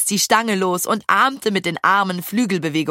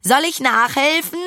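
raised, high-pitched call; 6 semitones higher than usual. A narrator's reading. Fee offers to push harder, calling out. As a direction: call the offer out over the ride. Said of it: "rief sie".

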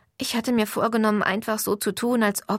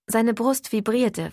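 usual speaking voice

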